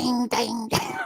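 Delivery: high chiming mock ding ding ding; playful sung-out ding imitation; imitated phone ding. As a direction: chirp it like a notification, rising up high. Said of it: "All I could hear was like".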